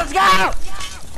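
loud, high victory cheer; excited. Real, not acted. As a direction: cheer the win at full voice. Celebrating the win.

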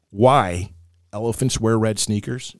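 usual speaking voice

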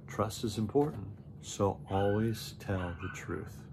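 usual speaking voice

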